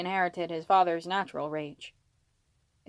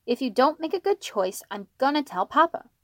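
put-on raised, higher child's voice; threatening child; 6 semitones higher, 4 dB louder than usual. Child warns brother he'll tell Papa, higher threatening voice. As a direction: warn like a kid about to tattle.

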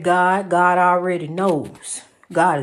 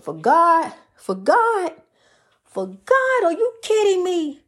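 for God climbing much higher each time; rising excitement; 7 semitones higher than usual. Real, not acted. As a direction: build it up, each for God higher than the last. Preacher building up for God.

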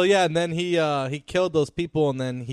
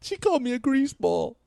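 high mock hurt; 10 semitones higher than usual.

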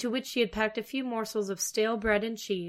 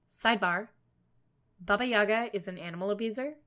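ordinary delivery

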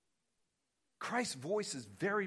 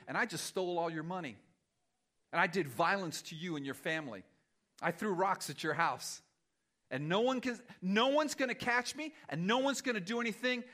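raised, sing-song sneer at someone's faith; mocking taunt; 3 semitones higher than usual. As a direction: play a gloating bully jeering at a believer. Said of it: "is mocking the righteous".

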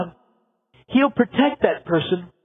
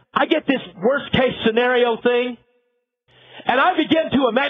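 ordinary talk

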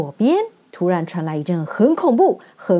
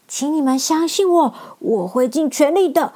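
nervous lion; 5 semitones higher than usual. Acted. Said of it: "很紧张地说".

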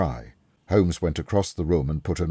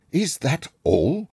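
slow, high, loud indignant question; angry; 13 semitones higher, 6 dB louder, slower than usual. A narrator's reading. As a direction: demand it angrily, pitch jumping up. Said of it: "Mister Solmes asked angrily".

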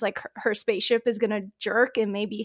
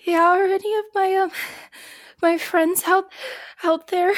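1 shaky high worry; 9 semitones higher, 5 dB louder than usual. Acted. Shaken pilot asks about friends.

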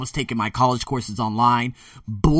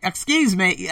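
put-on higher-pitched, botched Australian accent; 7 semitones higher, slower than usual. Imitating passengers on the flight, shaky attempt at Australian accent. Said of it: "can't even do the Australian accent".

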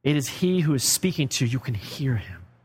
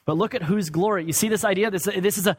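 ordinary talk, nothing marked

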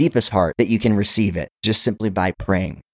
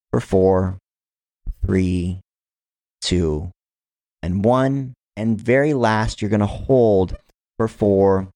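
guided breathing count. Shown as a demonstration.

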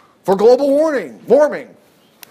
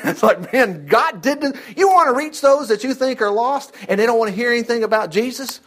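ordinary delivery

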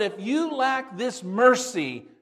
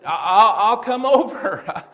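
stammering, half-laughing reluctant yes; hesitate, then give in with a nervous laugh; reluctant agreement; 2 semitones higher, 9 dB louder, slower than usual. Retold or imitated. The preacher reluctantly agreeing to visit, with a nervous stammering laugh.

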